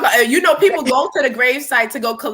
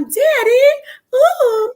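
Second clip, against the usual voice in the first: high, loud Southern belle Daddy; Southern belle accent; 4 dB louder, much slower than usual. Retold or imitated. Imitating Whitley calling Daddy, high Southern belle. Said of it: "it was really Southern accent".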